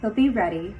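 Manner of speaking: usual speaking voice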